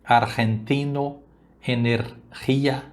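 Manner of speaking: "argentino" and "energia" slowly, with a rasping Spanish G. Shown as a demonstration. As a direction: stretch "argentino", "energia" with a throaty G. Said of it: "o mesmo vai acontecer".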